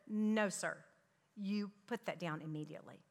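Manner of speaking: low, controlled retold mother's warning; imitating a no-nonsense mom, voice dropped; stern command. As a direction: order the child quietly and firmly. Mother's stern command to misbehaving child.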